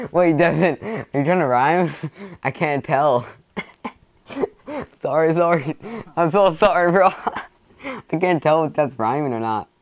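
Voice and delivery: giggling through the words; can't stop laughing. Real, not acted. Friend's clumsy rhyme cracks him up.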